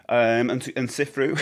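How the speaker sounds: ordinary delivery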